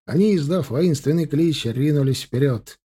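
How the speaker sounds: plain reading voice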